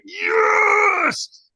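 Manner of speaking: very high, loud scream of yes; all-out yell. Retold or imitated. Pissed-off firewalker full of adrenaline, screaming. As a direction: let it rip at full power. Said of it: "threw my hands into the air".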